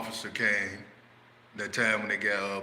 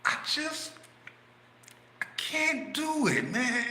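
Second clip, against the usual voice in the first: much higher put-on voice, slow; pleading refusal; 14 semitones higher, slower than usual. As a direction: beg off weakly. Friend refuses, very high.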